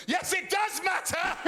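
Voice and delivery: gleeful big-brother yell; mock shout. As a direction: needle your sister, shouting. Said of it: "I went back to being an older brother".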